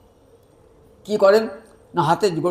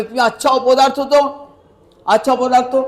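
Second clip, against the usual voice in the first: higher, louder mock scolding; acted scolding; 5 semitones higher, 5 dB louder than usual. Hungry brother scolds Jagannath over the unlit stove, acted exasperation. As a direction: tell him off in exasperation.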